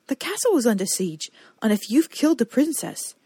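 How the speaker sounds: rising panicked plea; panicking. Acted. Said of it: "But he was panicking".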